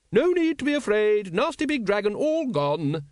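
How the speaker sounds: bright, high sing-out reassurance. A narrator's reading. Woman soothing nervous dragons at feeding time, brightly. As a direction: chirp it high, as if to little ones. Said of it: "she said brightly".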